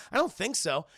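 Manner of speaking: normal talking voice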